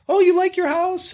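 high sing-song mock of a sweet realtor; hammed-up cutesy agent voice; sugary realtor mock. Investor mocking cute realtor talk, high sing-song.

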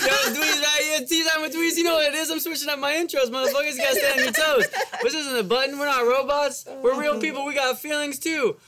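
high, loud show-intro voice; hype host voice. Acted. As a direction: pump up the crowd like a host. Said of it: "The fuck came out your mouth just now".

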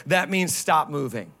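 usual speaking voice

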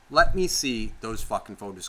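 normal talking voice

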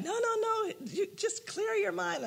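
high, light imitating voice; lightly voiced church ladies reassuring; imitated helpers. Imitating the people praying.

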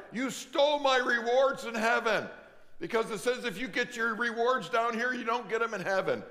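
imitating a shrill complaining woman; scolding lady. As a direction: voice the indignant churchgoer, loud and high. Lady scolding pastor on phone.

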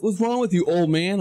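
high-pitched mocking challenge; imitated taunt. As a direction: mimic cheeky drivers needling him. Voicing the other drivers' taunt.

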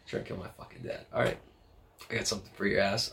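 whispered threat, then soft and fast; menacing whisper. Retold or imitated. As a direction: hiss it under your breath like a vow. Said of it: "I was like".

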